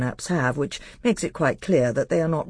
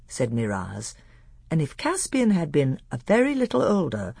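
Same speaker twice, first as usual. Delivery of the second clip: usual speaking voice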